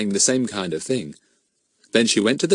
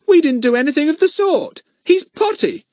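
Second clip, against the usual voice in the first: indignant protest; 5 dB louder than usual. A narrator's reading. Pip protests hotly.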